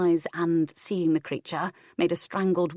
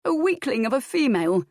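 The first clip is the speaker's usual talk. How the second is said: put-on higher, scornful boy; mock her weakness; sneering; 7 semitones higher, 3 dB louder than usual. A narrator's reading. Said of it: "he sneered".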